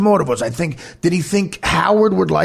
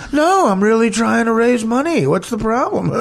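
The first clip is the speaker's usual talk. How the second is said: lightly voiced smug rich guy, a bit higher; a hint of a snooty rich friend feigning innocence; arrogant rich guy; 5 semitones higher than usual. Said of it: "he has an arrogant rich guy way of talking".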